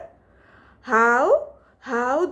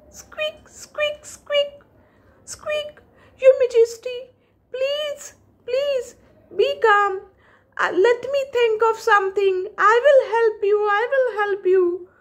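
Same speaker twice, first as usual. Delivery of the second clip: high, fast, eager mouse voice; squeaky urgent mouse; 10 semitones higher, faster than usual. Acted. Mouse telling the trapped lion to be calm, high and hurried. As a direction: rush to reassure like a tiny hero.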